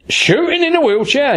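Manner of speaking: high, incredulous retold question; the prisoner can't believe a wheelchair user shoots; amazed question. Retelling the prisoner's disbelief, pitched high and amazed.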